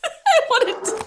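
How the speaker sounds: laughing. Real, not acted. Laughing at barking dogs.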